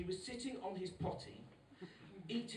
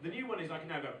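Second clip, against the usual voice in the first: ordinary talk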